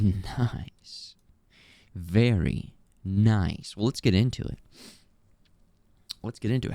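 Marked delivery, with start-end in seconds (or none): none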